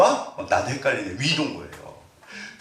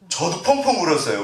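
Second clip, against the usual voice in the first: normal talking voice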